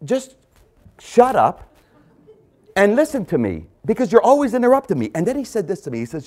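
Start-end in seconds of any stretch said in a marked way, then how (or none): 0.0-5.1 loud, higher mock "Shut up"; retelling snapping at the man to listen; retold exasperated telling-off. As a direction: reenact losing patience. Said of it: "So I was trying to tell him".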